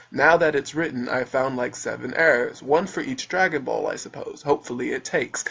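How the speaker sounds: low, measured reader's voice; put-on reading voice. Reading the back of his book aloud, low mannered delivery. Reads out the book's back cover.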